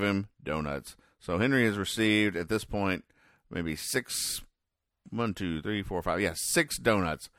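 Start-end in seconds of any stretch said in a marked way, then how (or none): none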